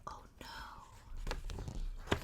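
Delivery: breathless scared whisper. Acted. Reader to children, worried whisper. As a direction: hush it in alarm. Narrator's fearful aside.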